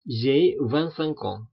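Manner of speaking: slow, careful j'ai vingt-cinq ans; slow French pronunciation model; slow French demo. Shown as a demonstration.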